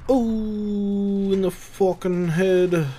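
usual speaking voice

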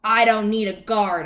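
exasperated leprechaun; irritated refusal. A narrator's reading. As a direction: refuse the dog loudly. Leprechaun snaps back at dog.

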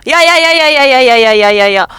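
loud frantic repeated yells at the cake; panicked yelling. Real, not acted. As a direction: yell at it to stay put. Cake about to fall, yelling.

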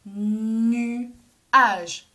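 "Nuage" with a long hummed N, pause before age; loud, slow two-beat "Nuage"; split into syllables. Shown as a demonstration. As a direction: stretch Nu, then a separate age in "Nuage". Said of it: "On tape dans les mains".

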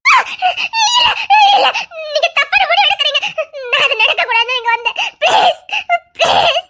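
high, desperate begging; frightened pleading. Acted. Girl begging the man not to harm her. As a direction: beg him to stop, terrified.

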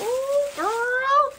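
very high, drawn-out coo; high-pitched excited exclamation; delighted squeal. Real, not acted. Delighted by what she unwraps.

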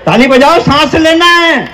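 high-pitched call for applause; calling to the crowd. Real, not acted. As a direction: lift the voice high and demand claps. Calls on audience to clap.

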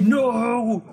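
imitating the game announcer's high, loud freak-out; announcer spazzing out. Mimicking the game announcer when you die, frantic high shout. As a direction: belt it like a frantic announcer on your death. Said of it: "He's, like, spazzing out".

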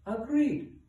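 very high, gleeful hippo voice; delighted high-pitched assent; happy singsong hippo. A narrator's reading. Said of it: "sang Hippo happily".

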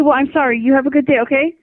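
usual speaking voice

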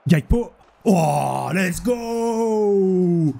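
high, drawn-out cheer; excited cheer. Real, not acted. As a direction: celebrate the goal. Celebrates a goal.

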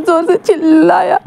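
slow, high, sobbing out the words; crying. Acted. Wing repeating it to his friend, sobbing. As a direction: let जोर से चिल्लाया break into a sob, slow and high. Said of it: "और wing just रोने लगता है".

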